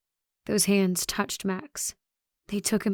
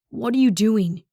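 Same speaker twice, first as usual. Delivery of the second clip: firm, voiced exasperated demand; mildly raised, incredulous voice; urgent challenge; 4 dB louder than usual. A narrator's reading. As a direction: demand an answer, openly alarmed. Confronts runner after pulling them back.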